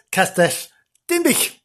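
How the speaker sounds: "Castell Dinbych" in Welsh, "Dinbych" jumping high; "Dinbych" flung up high, Welsh style; Welsh place name. Shown as a demonstration. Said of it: "Or in Welsh".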